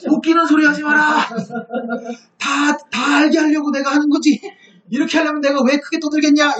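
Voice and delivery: high, loud mock shout of refusal; scornful loud retort. Assyrian envoy rejecting the envoys' plea, loudly scornful.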